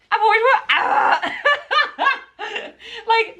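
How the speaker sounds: high, breathless laughing; giggling, overwhelmed with joy; laughing with delight. Real, not acted. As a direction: laugh through the excitement. Overwhelmed by opera tickets.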